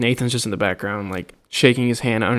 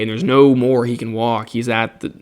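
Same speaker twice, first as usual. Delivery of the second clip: ordinary talk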